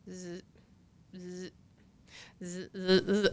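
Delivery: short, soft buzzing zzz; imitated phone buzz. Phone going off during the late-night call, imitated quiet buzzing. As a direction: mimic a phone vibrating in quiet bursts. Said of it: "I hear my phone go".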